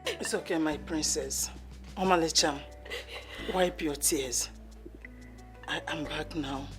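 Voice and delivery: soft, low comforting; soothing. Acted. Mother comforts her crying daughter, softly. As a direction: hush her gently.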